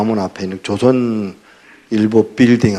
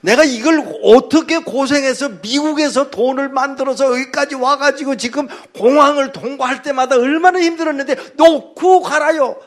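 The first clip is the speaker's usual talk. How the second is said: much higher and louder, aggrieved cry; exasperated outburst; 13 semitones higher, 4 dB louder than usual. Retold or imitated. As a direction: pour out the hardship in indignation. Frustrated pastor recalling hardship. (억울하게 높이 외치는 목소리)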